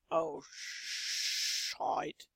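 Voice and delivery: ordinary talk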